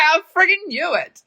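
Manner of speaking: high, excited told-you-so; triumphant. Retold or imitated. Guessing his hometown correctly, high triumphant crow. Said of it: "I was like".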